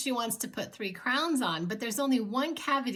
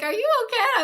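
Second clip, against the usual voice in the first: concerned dentist; 13 semitones higher, 8 dB louder, faster than usual. Retold or imitated. Dentist asks tearful patient.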